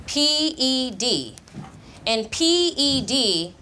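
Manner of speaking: slow letters. Shown as a demonstration. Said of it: "teacher repeats letters".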